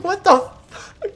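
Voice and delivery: high, drawn-out shock; mock total disbelief; stunned what the fuck. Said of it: "I'm like".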